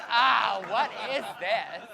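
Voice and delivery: shocked and slow on the question; boy recoiling in shock, loud and laughing; startled yelp. Retold or imitated. Startled reaction in the dark.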